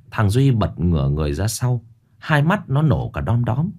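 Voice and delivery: plain reading voice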